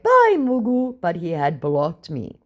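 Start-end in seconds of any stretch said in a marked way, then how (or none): 0.0-1.0 mocking farewell. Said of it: "And I went".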